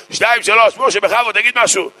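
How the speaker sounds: high, called-out cue to perform; hammed-up, high-pitched announcement; showman's countdown. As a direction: count him in with a big flourish. Repeats the miracle countdown.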